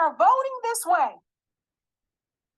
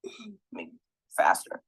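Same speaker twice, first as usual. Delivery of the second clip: whispered under the breath; whispered aside; 11 semitones lower, 7 dB quieter than usual. Real, not acted. Scrolling the vote list, whispering to himself. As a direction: whisper it to yourself.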